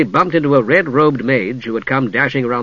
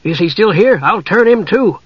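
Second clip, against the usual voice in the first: bold Kender voice; 7 semitones higher, 4 dB louder than usual. A narrator's reading. Kender boldly brandishes spoon.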